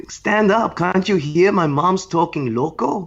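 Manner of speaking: high put-on tough guy voice, slow; mock tough son. Mocking son barks at the audience in a high put-on voice. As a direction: play the son snapping at the crowd. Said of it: "That's what he looked like".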